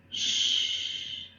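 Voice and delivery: soft shushing hiss for silence. Shown as a demonstration. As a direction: hush them like a librarian. Teacher illustrating the word quiet.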